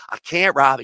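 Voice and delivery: lightly mimicked Southern refusal; sweet Southern accent. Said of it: "she had a sweet little Southern accent".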